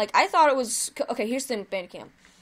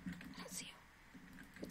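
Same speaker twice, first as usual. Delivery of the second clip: hushed whisper, slow and careful; 24 dB quieter, much slower than usual. Real, not acted. Gamer whispering while hiding from an enemy player. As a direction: breathe it so nobody hears you.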